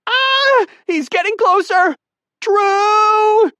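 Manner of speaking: very high, loud panicked shriek; naked woman screaming as the snake closes in; terrified scream. A narrator's reading.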